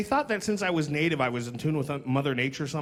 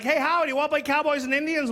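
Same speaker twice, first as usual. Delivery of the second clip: put-on high-pitched eager kid's call; imitating childhood friends; 9 semitones higher than usual. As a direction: pipe up like a kid inviting a playmate. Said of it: "All my friends like".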